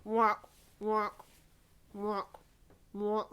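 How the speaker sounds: duck quacks. Shown as a demonstration. Leader quacking while pulling duck lips. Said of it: "make a quack noise whilst doing duck lips".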